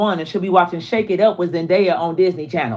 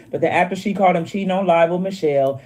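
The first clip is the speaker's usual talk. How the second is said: usual speaking voice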